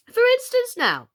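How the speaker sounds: very high, loud burst; sudden exclamation. A narrator's reading. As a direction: jump in excitedly. Queen suddenly announcing an example.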